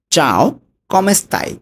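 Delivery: slow careful Italian demo; slow Italian model. Shown as a demonstration. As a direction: stretch out "চাও, কমে স্তাই?" syllable by syllable like a teacher. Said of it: "এটার Italian হবে".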